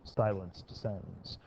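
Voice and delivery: low, hushed and slow; mock narration. Teacher narrating the class's silence after a question, mock-solemn and hushed. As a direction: narrate the lull slowly, in a soft low voice.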